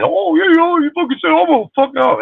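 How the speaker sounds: very high squeaky mock threat; mocking tough-guy friend. Streamer mocking a troll's friend in a high squeal.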